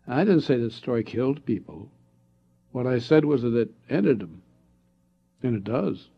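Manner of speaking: ordinary delivery, nothing marked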